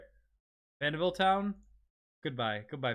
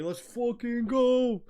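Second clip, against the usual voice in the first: high, slow hype shout; hype cheer; 7 semitones higher, 4 dB louder, slower than usual. Real, not acted. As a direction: celebrate loudly, drawn out. Celebrating hundred followers.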